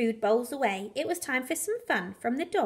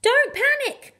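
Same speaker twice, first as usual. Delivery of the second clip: much higher, louder bark; barked passionately; 12 semitones higher, 6 dB louder than usual. Acted. Clive barks at the fleeing dogs, loud and high. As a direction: command them over the chaos. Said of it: "barked Clive in a great fit of passion".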